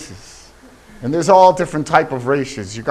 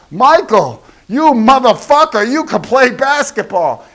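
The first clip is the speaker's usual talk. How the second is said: higher, louder admiring shout; excited praise; 9 semitones higher, 5 dB louder than usual. Pretend. Praising Michael Jordan to his face, loud and excited. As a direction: hype up a star athlete loudly. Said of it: "went up to Michael Jordan and go".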